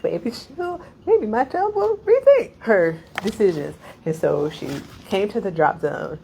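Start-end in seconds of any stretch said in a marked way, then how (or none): 0.0-3.8 high, put-on voice of a mother hoping her child reconsiders; mom's hopeful inner voice. Narrator voicing mom's hope that she'll rethink. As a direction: lift into a sing-song hopeful mom voice. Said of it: "hear and be like".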